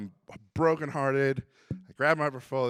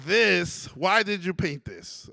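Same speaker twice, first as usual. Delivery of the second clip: louder, emphatic question, slowed down; emphatic teacher; 4 semitones higher, 7 dB louder, slower than usual. Retold or imitated. Teacher demanding to know why he painted it, louder. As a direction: press him for the answer. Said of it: "He said".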